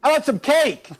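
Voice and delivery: calling out to neighbor Troy. Retold or imitated. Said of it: "I'm like".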